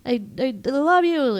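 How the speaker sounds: high, stammered declaration; mock love confession. Recapping him confessing at the party, high and stammering. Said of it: "he's like".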